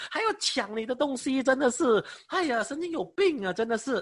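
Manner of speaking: indignant retort. Retold or imitated. Preacher acting out his retort to a former pastor, high and scornful. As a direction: scoff at him like he's crazy.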